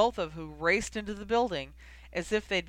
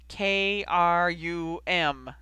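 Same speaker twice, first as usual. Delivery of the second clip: slow, louder, letter by letter through gritted teeth; 6 dB louder, much slower than usual. A narrator's reading. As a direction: spell it out, barely holding back irritation. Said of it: "through gritted teeth".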